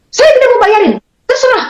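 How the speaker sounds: high-pitched mock outburst; retold angry refusal. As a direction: imitate an angry husband washing his hands of it. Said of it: "dia marah-marah".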